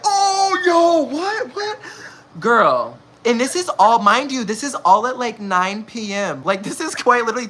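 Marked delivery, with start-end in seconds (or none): 0.0-1.8 shocked friend. Retold or imitated. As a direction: mimic the friend's high, shocked squeal. Friend reacts shocked to gossip.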